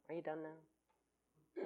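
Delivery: drawn-out, unimpressed calm; deadpan. Retold or imitated. Partner calmly answering a tantrum, slow and deadpan. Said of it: "look at me and go".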